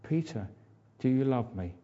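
soft, patient asking; gentle question. Retold or imitated. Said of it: "That's all he says".

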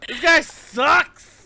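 high, very loud, slow jeer; loud drawn-out heckle; indignant shout. Real, not acted. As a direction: shout your contempt for the villain. Player rails at the non-player character.

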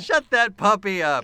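loud, high, exasperated yell. Real, not acted. Host to a barking dog mid-recording, exasperated yell.

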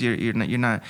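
usual speaking voice

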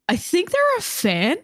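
unsure what's going on; confused. Retold or imitated. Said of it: "very confused".

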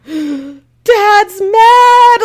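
loud, high-pitched comic wail; mock crying wail. Hiker dreading the scolding from Sean, mock tearful wail. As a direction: exaggerate a child's tearful panic. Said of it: "like, crying even more".

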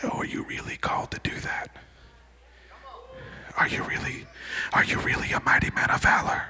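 soft, insinuating whisper; tempter's hushed questioning; enemy's doubting voice. Acted. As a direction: whisper doubt into the listener's ear. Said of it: "then you hear that voice".

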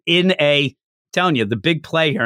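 ordinary talk, nothing marked

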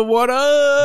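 very high, stretched "what up"; high-pitched showy greeting; demonstrating intro catchphrase. Shown as a demonstration. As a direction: hold "up" long and high. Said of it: "I was going the".

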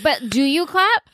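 high, puzzled crowd voice; mock the guests' confusion; mock bewildered crowd. Podcaster mocking the awkward gala crowd, puzzled and high.

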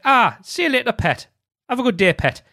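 high, cheery Geordie greeting; North East accent. Shown as a demonstration. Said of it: "people from the northeast might say".

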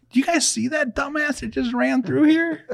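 high-pitched mock disbelief; feigned innocent astonishment. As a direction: play the innocent bystander, amazed. Feigning innocence after streaking.

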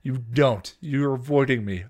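heated accusation; accusing. A narrator's reading. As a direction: push back hard in a quarrel. Younger sister accuses again.

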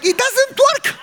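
high, exasperated cry of frustration; a sudden squeaky shout at a broken van; frustrated yell. Retold or imitated. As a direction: yell it out, fed up with the car. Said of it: "I was like".

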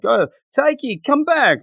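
high-pitched mock call after the boy; high, pleading call across the gym; calling after him. Girl calls after running boy.

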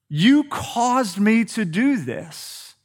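slow, drawn-out mock blame; a touch of whiny blaming. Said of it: "putting that sin on the other person".

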